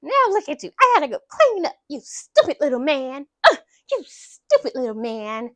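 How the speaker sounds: retold scolding. Captor berates man after smearing food, retold scolding. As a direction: scold him like a put-on exasperated captor.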